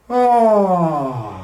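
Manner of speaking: high, loud sigh sliding down like a yawn. Shown as a demonstration. Voice teacher leading a yawn exercise, loud falling sigh. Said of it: "relaxing it out with a yawn".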